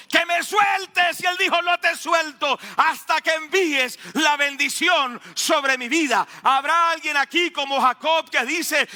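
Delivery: high, shouted pulpit exhortation; full-force preaching. Acted. Preacher as Jacob refusing to let go, high impassioned shouting. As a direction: build to a fervent shouted challenge.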